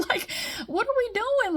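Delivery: high laughing exasperation; giggling, incredulous question; laughing disbelief. Real, not acted. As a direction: laugh it off, baffled. Laughing at the absurdity.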